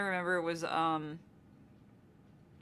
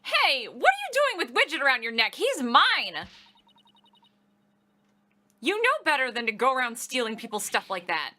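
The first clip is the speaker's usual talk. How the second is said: indignant scolding; 3 semitones higher, 6 dB louder than usual. Acted. Character scolds thief.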